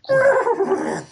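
loud. A narrator's reading. Narration spikes on fall.

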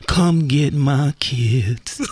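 low, very drawn-out put-on voice; Oprah film character. Mocking Oprah's film lines to co-hosts, low slow put-on voice. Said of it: "Oprah doesn't talk like that".

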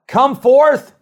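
high, loud royal summons; king calling out. Retold or imitated. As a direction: bellow it across the furnace like a king. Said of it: "He said".

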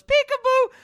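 put-on very high, sing-song little girl; little girl's peekaboo. Host imitating the little girl's peekaboo, high chirp. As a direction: chirp it like a small child. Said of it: "And then she goes".

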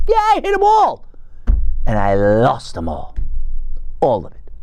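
sarcastic triumph collapsing. Real, not acted. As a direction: ironic boast. Ironic diet goals.

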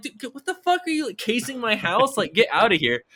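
high-pitched, indignant outburst; exasperated telling-off. Retold or imitated. Homeowner sending a nosy kid away, exasperated yelling. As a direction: chew him out in disbelief.

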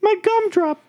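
tiny, very high, slow squeak of a cookie man; Gingerbread Man impression. Podcaster imitates Gingy for his friends, squeaky high. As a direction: squeal it like a frightened little cookie. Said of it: "that's the gingerbread man".

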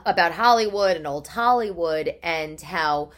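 ordinary talk

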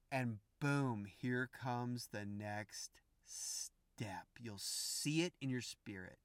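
hushed whisper, slow; whispered reveal. Real, not acted. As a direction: breathe out the reveal.

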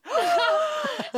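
high squealing laugh; helpless giggle; burst of laughter. Real, not acted. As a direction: laugh out loud at the joke. Laughing at own joke.

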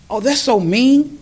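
lightly voiced outraged youngster; indignant kids. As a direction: play the kid who's shocked by it.

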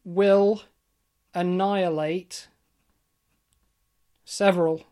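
slow, weighty word-by-word reveal; dramatic reveal. Real, not acted. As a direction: drop each word like a bombshell.

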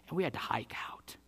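a breathy aside under the breath; whispered. Real, not acted. Pastor telling the congregation he had to turn back, whispered. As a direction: drop to a whisper as if it costs him.

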